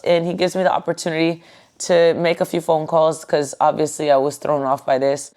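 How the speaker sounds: plain reading voice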